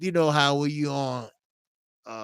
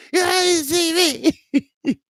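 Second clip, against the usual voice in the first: mock startled; 4 dB louder than usual. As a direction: draw it out, mocking the caught guy. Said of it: "quoting the caught viewer".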